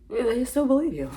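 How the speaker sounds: high, loud gloating burst; gleeful high squeal. Acted. Mocking the public, a high gleeful squeal. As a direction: crow it with glee.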